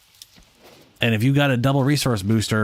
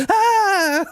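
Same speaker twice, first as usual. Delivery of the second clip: sudden high scream; jump-scare shriek; startled scream; 4 dB louder than usual. Real, not acted. Startled by own character.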